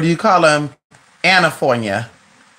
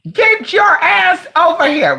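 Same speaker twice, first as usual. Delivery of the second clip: put-on much higher, loud, drawn-out yell at a child; mock mom yell; 11 semitones higher, 3 dB louder, much slower than usual. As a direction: play an angry mother calling her kid in. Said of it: "you go".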